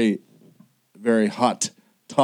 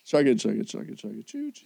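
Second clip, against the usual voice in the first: chugging toy train voice; 5 dB quieter than usual. Shown as a demonstration. Train sound after debate.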